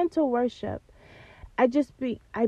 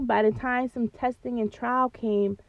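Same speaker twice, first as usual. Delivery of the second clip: ordinary talk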